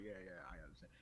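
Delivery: low, very soft concession; deflated retold mumble; resigned. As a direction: give in under your breath. Retold rival gives up.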